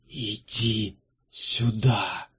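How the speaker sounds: slow, eerie call from the dark; drawn-out spectral whisper-call; ghostly voice. Acted. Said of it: "позвал её голос из темноты".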